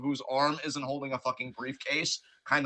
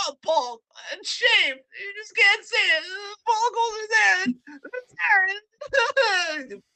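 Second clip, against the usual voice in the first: mock whiny Ricochet; 6 dB louder, slower than usual. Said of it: "you're just gonna crumple like".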